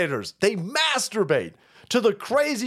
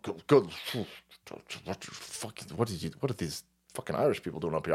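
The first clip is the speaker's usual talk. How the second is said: fast muttered aside; 12 semitones lower, 9 dB quieter than usual. Real, not acted.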